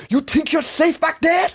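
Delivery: high, loud taunting, slow and deliberate; aggressive challenge. Retold or imitated. Dad taunting dealership managers to fight, aggressive yelling. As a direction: goad them out from behind the counter.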